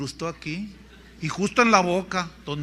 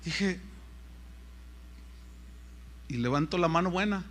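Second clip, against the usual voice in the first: ordinary delivery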